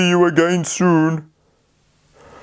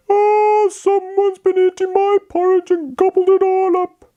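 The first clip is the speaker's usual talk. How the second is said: put-on very high, whiny baby bear voice; a tearful little bear, pitched way up; crying Baby Bear. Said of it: "started to cry".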